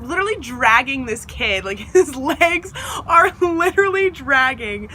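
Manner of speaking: normal talking voice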